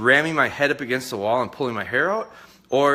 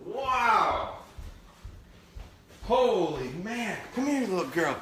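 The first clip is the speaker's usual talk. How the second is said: drawn-out, higher-pitched wonder; amazed delight; 8 semitones higher, 5 dB quieter, slower than usual. Real, not acted. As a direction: marvel at the puppy's success, stretching the words. Delighted after puppy's successful retrieve.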